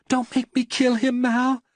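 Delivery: a cornered wife begging in panic; frightened woman. A narrator's reading.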